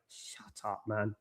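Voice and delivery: hushed, under-the-breath retort; quiet shut up. Retold or imitated. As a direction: say it low to yourself. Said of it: "like, I'm like".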